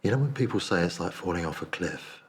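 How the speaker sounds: plain reading voice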